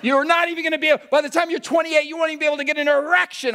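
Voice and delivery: yelped high and fast through laughter; mock dramatic warning. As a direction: scare him with a hammed-up shriek. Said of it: "with an emphasis on erection".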